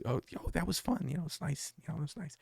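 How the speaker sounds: high, very soft little voice; imitating daughter. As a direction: mimic an underwhelmed daughter, softly and high. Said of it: "So my daughter has this".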